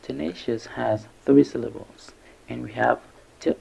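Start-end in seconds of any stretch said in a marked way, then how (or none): none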